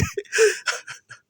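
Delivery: high squealing laugh; laughing hard at the punchline; burst of laughter. Real, not acted. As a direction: break into a shrieking laugh. Teller cracks up at own punchline.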